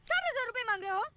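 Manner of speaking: high, loud correction; exasperated scolding. Acted. Hostage scolding her kidnapper over the ransom, exasperated. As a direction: berate a clueless accomplice.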